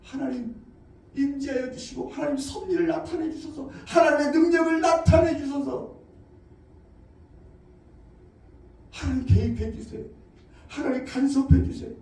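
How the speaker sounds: soft, hushed pleading prayer; quiet prayer. Shown as a demonstration. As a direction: beg God gently. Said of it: "하나님의 도움을 요청하는 거예요".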